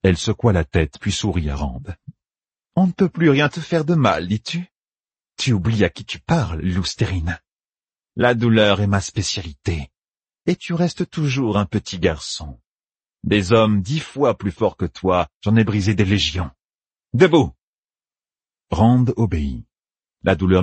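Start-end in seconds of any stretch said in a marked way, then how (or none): 2.8-16.5 a touch higher, cool taunting tormentor; lightly voiced menacing villainess. Tormentor boasts to her captive of breaking men, lightly menacing. 17.1-17.5 higher, louder one-word order; sharp command. Acted. Tormentor orders her captive up, sharply commanding.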